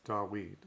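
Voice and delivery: "Dawid" as dah-WEED, with a U-like glide; Dawid with U sound. Shown as a demonstration. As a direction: pronounce "Dawid" with W in place of V. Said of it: "because it's spelled with a U sound".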